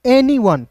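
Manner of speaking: "एनी वन" as two clear words; right pronunciation. Shown as a demonstration. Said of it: "पढ़ेंगे वन". ("एनी वन" का उच्चारण)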